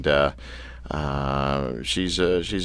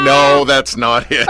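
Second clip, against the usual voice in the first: real laughter through the words, louder and slow; laughing; 2 semitones higher, 6 dB louder, slower than usual. Real, not acted. Host laughing at his own Dutch oven joke, laughing hard. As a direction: crack up as you say it.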